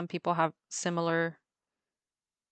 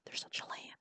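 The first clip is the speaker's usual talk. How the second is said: unvoiced conspiratorial whisper; hushed tip-off, breath only; 16 dB quieter than usual. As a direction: whisper it to the people beside you. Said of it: "I tell everybody near me".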